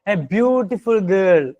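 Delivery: "beautiful" drawn out and leaned on, very slow; slow model phrase with "beautiful" pressed; stress on beautiful. Shown as a demonstration. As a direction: dwell on "beautiful" so the describing word stands out. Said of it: "എങ്ങനെ?".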